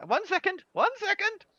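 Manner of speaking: put-on high-pitched old halfling calling out; Polly. Halfling grandmother calling to customers from out back, high call. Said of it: "you hear a voice go".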